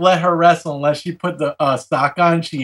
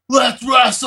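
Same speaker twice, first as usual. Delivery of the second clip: put-on much higher, eager kid's shout; gleeful call; 7 semitones higher than usual. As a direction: yell it the moment the parents leave. Kid calling as door slams.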